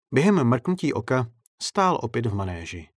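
ordinary delivery, nothing marked

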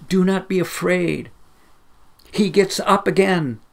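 put-on boy crying out. Young boy in the church calls out eagerly during the film. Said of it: "a young boy suddenly cried out".

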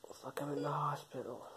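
faint breathy whisper from a hospital bed; weak whisper. Acted. Injured man whispers to himself in hospital. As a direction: barely voice it, hurt and weak.

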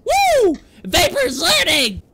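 high loud whoop then slow glee; cheering. Real, not acted. Gamer cheering with dad, high excited whoop. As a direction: celebrate the win.

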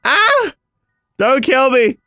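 ordinary talk

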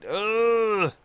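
high drawn-out groan; exasperated groan. Real, not acted. Gamer late for work in the game, exasperated groan. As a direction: groan at a setback.

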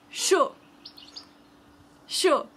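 chaud, no final sound; French chaud. Shown as a demonstration. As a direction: model chaud crisply. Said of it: "is going to be hot".

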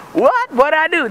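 high-pitched acted disbelief; mock startled protest. Robber to arresting cops, mock startled protest. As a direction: play the baffled suspect, pitched high.

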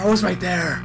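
ordinary talk, nothing marked

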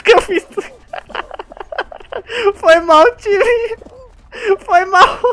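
high, breathless laughing apology; laughing at own blunder. Real, not acted. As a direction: laugh through the sorry. Caused team fail, laughing hysterically. (rindo sem parar, pedindo desculpa)

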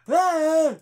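short high, loud yell; imitated jump-scare shriek; mock startled scream. Imitating the scare right up in his face, mock scream.

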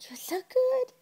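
high breathy whispered coo; squeaky praising a dog. Real, not acted. Doting on dog.

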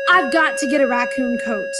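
a touch of passionate urgency; passionately. Acted. Roommate insisting on the coat, mildly passionate. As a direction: plead it with feeling. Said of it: "he said passionately".

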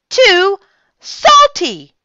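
high, loud, drawn-out complaint; disgusted cry. A narrator's reading. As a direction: cry out slowly after a horrible sip. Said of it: "cried Missus Hagenmuller".

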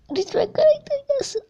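high-pitched hopeful begging; high pleading. Real, not acted. Pleading for a character drop.

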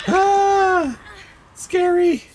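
high, loud playful fright; mock scared. Gamer teases the killer, playful high fright. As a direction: ham up being scared.

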